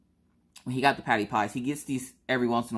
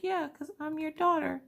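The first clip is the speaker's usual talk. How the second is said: put-on much higher, matter-of-fact child voice; little girl voice. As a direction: answer like a cheeky little daughter. Voicing daughter Maggie.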